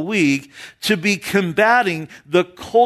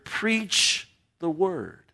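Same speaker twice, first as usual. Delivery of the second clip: slow, grave, quieter command; solemn order; 7 dB quieter, much slower than usual. Retold or imitated. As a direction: lay it down like a solemn military order. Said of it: "I'm giving you this solemn order".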